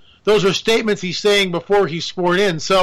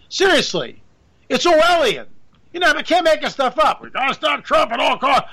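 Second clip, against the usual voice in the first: ordinary talk, nothing marked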